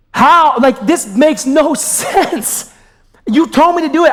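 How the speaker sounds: venting hurt at God over closed doors; frustrated outcry. Retold or imitated.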